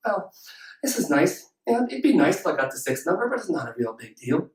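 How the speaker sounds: low, soft, deadpan indifference; underwhelmed. Pretend. Lottery winner brushing off the big win, underwhelmed. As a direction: shrug it off in a mock muted voice. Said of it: "Are you sitting there saying".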